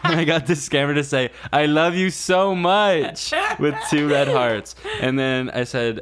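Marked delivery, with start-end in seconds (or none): 1.5-3.3 mock sappy declaration. Scammer quoted declaring love.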